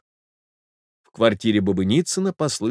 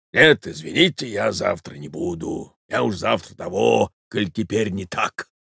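grumbling; 2 semitones lower than usual. Acted. Offended gentleman declining the invitation, grumbling. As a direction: grumble it off under his breath. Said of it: "проворчал что-то ещё сквозь зубы".

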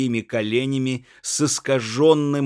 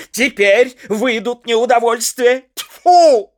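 much higher, louder furious yelling; shouting; 9 semitones higher, 8 dB louder than usual. A narrator's reading. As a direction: drive her out of the room, spitting in disgust. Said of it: "Бугров помолчал и крикнул". (яростный крик)

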